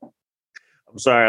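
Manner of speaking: ordinary delivery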